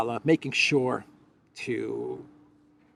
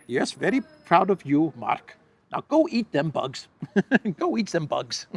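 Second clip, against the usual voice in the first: mock Klaus; 5 semitones higher, 4 dB louder than usual. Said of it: "he called Klaus Schwab".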